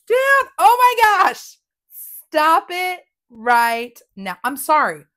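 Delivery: loud, high squeal of excitement; thrilled outburst. Real, not acted. Crafter seeing the stencil result, loud thrilled outburst. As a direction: burst out loudly, pitch shooting up.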